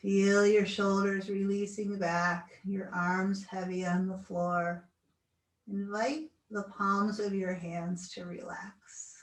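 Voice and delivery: slow, soothing guided relaxation. Acted. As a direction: talk them gently into stillness. Leads final relaxation.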